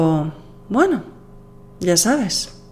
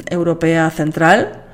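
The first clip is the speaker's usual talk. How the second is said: ordinary talk, nothing marked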